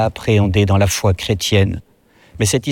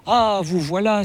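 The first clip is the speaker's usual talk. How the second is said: high-pitched exclamation of welcome; exclaiming; 10 semitones higher, 3 dB quieter than usual. A narrator's reading. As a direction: exclaim it high, without raising the volume. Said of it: "s'écria l'évêque".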